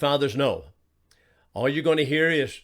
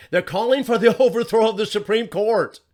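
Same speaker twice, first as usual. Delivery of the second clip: higher, louder, laughing in disbelief; incredulous laugh; 6 semitones higher, 4 dB louder than usual. Real, not acted. Host scoffing at Democrats' reaction to the ruling, laughing. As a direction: laugh through it at how absurd it is.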